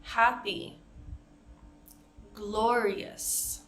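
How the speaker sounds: "Happy," and "glorious." said clearly as a model for repeating; slow clear model of "Happy," "glorious."; teacher models the long E in "Happy," "glorious."; model words. Shown as a demonstration. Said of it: "Pause to practice".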